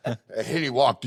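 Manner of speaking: normal talking voice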